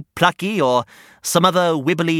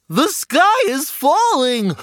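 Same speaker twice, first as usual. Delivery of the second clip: higher, slow honked goose voice; honking goose; 10 semitones higher, slower than usual. Acted. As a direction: honk it out like a goose. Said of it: "honked Goosey Lucy".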